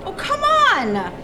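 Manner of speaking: drawn-out exasperated protest. Acted. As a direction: scoff it out slowly. Bitter protest to rival performer.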